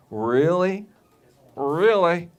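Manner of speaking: incredulous high "Really?"; mock disbelieving woman; doubting. Skeptical woman quoted doubting.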